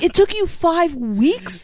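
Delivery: high, drawn-out disbelieving question; incredulous, pitched well up and slow; mock incredulity. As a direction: stretch it out in retold amazement. Acted incredulous reply to Rob.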